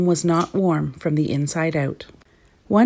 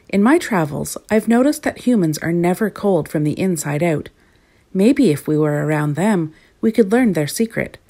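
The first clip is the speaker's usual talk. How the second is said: ordinary delivery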